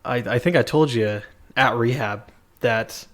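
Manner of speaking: usual speaking voice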